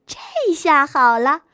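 usual speaking voice

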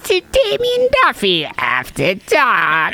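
high, slow cackling ghoul host; drawn-out spooky horror host; Crypt Keeper voice. Acted. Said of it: "I can't sustain this Crypt Keeper voice".

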